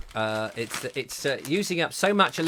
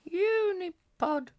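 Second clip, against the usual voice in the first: squeaky puppet voice; 3 dB quieter, much slower than usual. Pretend. Naming the puppet in its voice.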